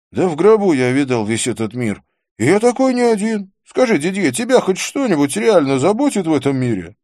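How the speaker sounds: loud, high, scornful character voice; Vikram. Acted. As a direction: challenge the table, high and loud.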